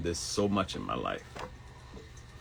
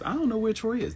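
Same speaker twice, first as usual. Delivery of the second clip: mock excuse; faster than usual. Mock claim by others.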